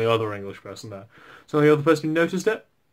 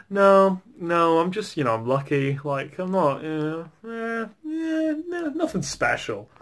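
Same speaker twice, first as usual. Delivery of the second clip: flustered modest denial; 5 semitones higher, slower than usual. Retold or imitated. Brushing off a Polish girl's maths compliment, flustered and modest. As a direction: stammer out a humble denial, halting near the end.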